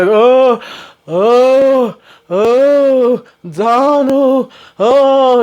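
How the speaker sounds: high, drawn-out hammed-up moans; mock pained moaning. Lily coming down the stairs to Donald, mock pained moaning. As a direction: imitate someone moaning in pain.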